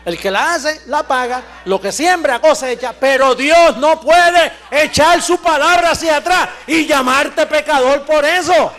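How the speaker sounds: preacher rousing the faithful, loud and fervent; full-force preaching. Acted. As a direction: drive it home from the pulpit.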